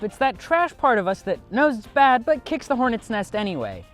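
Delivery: usual speaking voice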